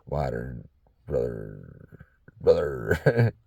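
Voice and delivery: very low, mock-Southern brother; fake Southern accent. Speaker playing to a wider audience, fake Southern accent. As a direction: drop deep into a hammed-up Southern accent. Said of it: "southern accent that I'm half-ass faking".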